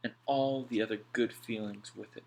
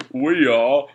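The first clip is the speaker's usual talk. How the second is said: louder, rueful chuckle then answer; wry laugh; 5 semitones higher, 13 dB louder than usual. A narrator's reading. Alf answering Mac with a rueful laugh. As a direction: laugh at their own bad luck.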